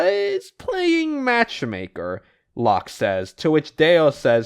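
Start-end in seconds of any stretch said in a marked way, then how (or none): 0.0-2.2 high, drawn-out teasing singsong; mocking singsong. Recapper mimics a gossip's matchmaker jibe, mocking singsong. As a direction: stretch it out slowly, way up high.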